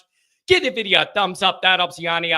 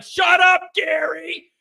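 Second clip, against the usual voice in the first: retold coworker shout; 9 semitones higher, slower than usual. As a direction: bark at Gary to shut up. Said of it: "He's like".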